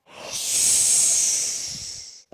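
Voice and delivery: long breathy cat hiss. Acted. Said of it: "this cat says".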